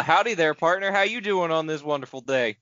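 big howdy-partner country twang; country accent. Shown as a demonstration. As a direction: ham up a friendly country accent. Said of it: "Give us a quick country accent".

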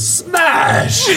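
very high-pitched yelled crash; imitated crash. Storyteller voices the car crash the brother heard, high yell. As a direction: shriek out a crash sound. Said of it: "all my brother heard was".

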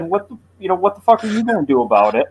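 high, fast laughing voice for a defiant deer; cocky buck. Retold or imitated. Driver voicing a deer that licked his headlight: laughing cocky challenge. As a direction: voice the buck's cheeky challenge, laughing. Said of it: "basically said".

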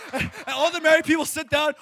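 ordinary delivery

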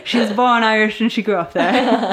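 put-on playful Irish accent through laughter; bad Irish accent. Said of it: "I apologise for my accent".

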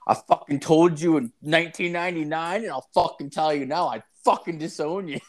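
half-mocking his father's stubborn repeat; imitating dad.

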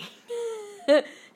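bright burst of high-pitched laughter. Real, not acted. Recalling the slang mix-up with Lulu, a high amused laugh.

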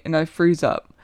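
ordinary delivery, nothing marked

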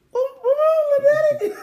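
high, loud, hammed-up sob; mock crying wail. Dad retells tearing up beside his son, mock crying wail. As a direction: wail it like a comic meltdown.

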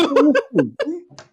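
high helpless laugh; helpless laughter. Real, not acted. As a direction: laugh until you can't stop. Laughing hard.

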